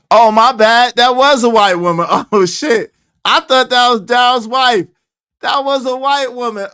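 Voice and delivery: high, loud, startled admission; embarrassed realization. Real, not acted. Host realizing on stream he was wrong, loud and startled. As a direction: blurt it out as it dawns on you.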